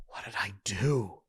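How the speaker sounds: low, softened mock-wounded question; mock hurt. What an insecure wife would default to, mock hurt. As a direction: play the anxious partner, hushed and low. Imitates an anxious partner's default reaction.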